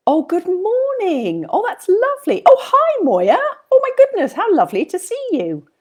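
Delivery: high-pitched joy, friend arriving; delighted greeting. Real, not acted. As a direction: gush over a friend you haven't seen in years. Greeting old school friend live.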